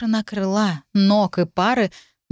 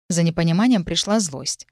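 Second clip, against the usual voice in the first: plain reading voice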